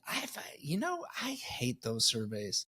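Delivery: unvoiced whispered aside. Real, not acted. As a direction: hush it like a confession. Whispered aside about surveys.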